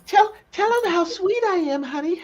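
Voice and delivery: very high, loud caricature of a wife; mock wife impression. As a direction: squeal it sweetly, mocking the wife. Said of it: "I don't really sound like that".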